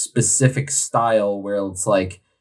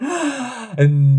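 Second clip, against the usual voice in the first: dramatic gasp; 11 semitones higher than usual. Acted. Dramatic reveal of beer name.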